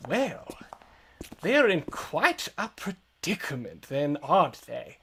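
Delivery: put-on lilting old wizard with deliberate pauses; old man wizard. Unseen wizard remarking on the party's predicament, musing old-man voice. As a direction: play a mildly amused old wizard. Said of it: "old man wizard".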